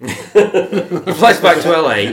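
slow, laughing through the words; chuckling as you tell the friends the next bit, laughing. Real, not acted. Giggling at the absurd story, slow and laughing.

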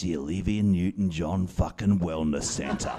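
low, soft confiding tone; mock patient voice. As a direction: imitate a patient talking from the bed. Retells Fiona speaking from hospital bed.

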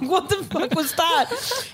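high, slow, incredulous; waking up baffled, slow and high; stunned disbelief. Retold or imitated. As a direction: drag it out in disbelief. Morning disbelief quote.

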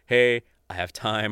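ordinary talk, nothing marked

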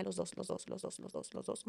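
repeated softly, fading out; fading to a whisper. Retold or imitated. Caller telling hosts both were online, fading to a whisper. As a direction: let it die away to a whisper.

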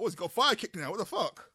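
loud, high-pitched incredulous outburst; retold disbelief. Retelling his reaction to a fiery kick, incredulous yell. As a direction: shout it high, reliving the disbelief.

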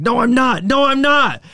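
put-on high, loud drunk screaming. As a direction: scream the denial at the bartender. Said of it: "the guy just starts screaming".

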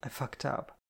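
soft, halting admission; hesitant confession. A narrator's reading. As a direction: get it out quietly. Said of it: "I tried to start".

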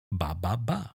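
put-on mirroring baby babble. Shown as a demonstration. Parent copying the baby's babble, softly. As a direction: echo the baby's sounds gently. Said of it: "and you say".

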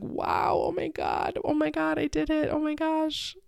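re-enacted giddy disbelief; so excited. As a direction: marvel in a small high voice. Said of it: "You know, just so excited".

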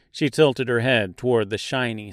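ordinary delivery

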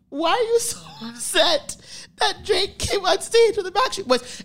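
laughing disbelief. Retold or imitated. Her incredulous reply to mom.

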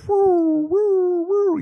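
high sustained woo of a siren; siren imitation. Hearing the ambulance approach, high siren wail. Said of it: "all of a sudden you hear".

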